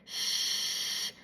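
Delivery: a slushy shh with the air leaking out the sides; side-air shh demo. Shown as a demonstration. Speech teacher demonstrating air escaping at the sides, slushy shh. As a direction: let the air spill over the sides of the tongue. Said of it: "If it's on the sides, it will sound like this".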